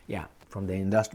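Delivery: usual speaking voice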